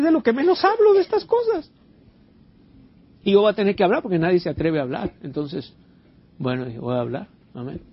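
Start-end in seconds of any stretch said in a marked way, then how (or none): none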